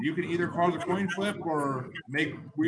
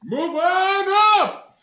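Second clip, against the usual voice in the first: very high, slow loud shout; hype shout; 11 dB louder, much slower than usual. Acted. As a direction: belt the title like an announcer. Game host hypes song title.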